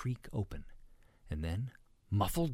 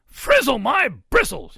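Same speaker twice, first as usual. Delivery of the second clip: slow, high, huge exclamation; roar; 16 dB louder, slower than usual. A narrator's reading. Papa pig slaps his belly, roaring. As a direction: roar it like an astonished hog. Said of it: "he roared".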